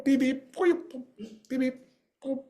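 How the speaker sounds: high droid bleeps with gaps; high-pitched beep-boop noises. Retold or imitated. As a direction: imitate a Star Wars robot chattering. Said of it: "R two D two, you know, from Star Wars".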